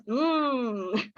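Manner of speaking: long drawn-out yummy hum; savouring "mmm", stretched slowly; delicious mmm. Shown as a demonstration. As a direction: hum as if tasting something delicious. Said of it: "you think it's delicious".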